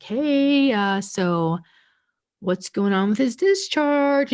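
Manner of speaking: high, overly sweet and slow polite question; mock polite query. Daughter sweetly pressing the nurse about her dad's discharge, mock polite.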